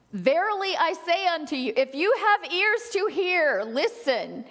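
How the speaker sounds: high, loud biblical proclamation; loud preaching. Acted. Jesus proclaiming to his disciples, loud and high. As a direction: proclaim it like a prophet to the crowd.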